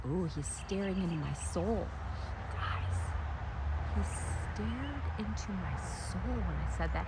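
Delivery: quiet awed aside; hushed. Real, not acted. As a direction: hush as if not to spook him. Hushed when bird stares.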